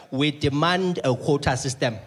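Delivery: slow, deliberate politician's demand; pompous minister. Retold or imitated. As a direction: declaim it like a politician at a podium. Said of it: "ministers that are like".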